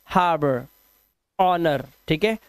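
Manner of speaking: normal talking voice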